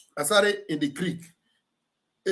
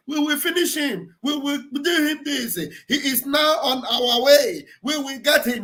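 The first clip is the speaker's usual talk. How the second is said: mock shouting; 7 semitones higher than usual. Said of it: "they must have been shouting there".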